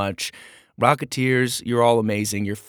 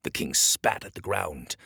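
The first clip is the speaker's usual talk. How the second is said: usual speaking voice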